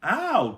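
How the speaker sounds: high loud ow; pained ow. A narrator's reading. Jack yelps when Annie's voice booms in his ear. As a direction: yelp as your ear rings.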